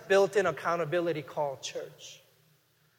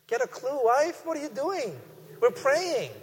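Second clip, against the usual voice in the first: higher-pitched, exasperated husband scolding his wife; mock scolding; 7 semitones higher than usual. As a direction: act out scowling at an interrupting wife, without shouting. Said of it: "I would scowl at and say".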